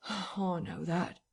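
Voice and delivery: slow, soft, breathed-out sigh. Acted. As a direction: let it out on a weary sigh. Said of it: "sighed Mister Marvel".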